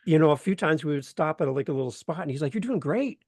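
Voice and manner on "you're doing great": cheerful encouragement. Retold or imitated. Mentor encouraging on bike ride.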